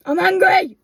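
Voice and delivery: high, loud comic yell; hammed-up furious shout; mock poem outburst. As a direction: shout it as a joke angry poem. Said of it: "A poem by Gemma Saxton".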